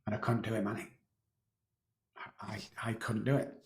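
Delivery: very soft, broken admission; quietly grieving; choked-up confession. Real, not acted. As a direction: barely get the words out. Emotional confession.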